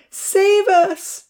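high, loud begging cry. A narrator's reading. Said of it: "Rosa begged".

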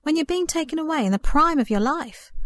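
asking in wide-eyed disbelief; amazed disbelief. A narrator's reading. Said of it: "in amazement at Verity's peaceful composure".

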